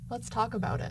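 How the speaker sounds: loud, emphatic example line; example sentence spoken loud. Shown as a demonstration. Narrator plays the example sentence for viewers, loud and emphatic. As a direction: project the demo sentence strongly. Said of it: "Let's look at an example sentence".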